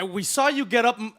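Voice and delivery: ordinary talk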